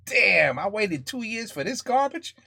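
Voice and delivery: hammed-up grumble over a disappointing game; mock whiny complaint. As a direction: whine like a let-down fan. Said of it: "bitch and complain and be like".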